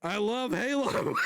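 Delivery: high, tearful laugh through the words; real crying and laughing. Real, not acted. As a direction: blurt it out half laughing, half crying. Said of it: "I'm literally crying right now".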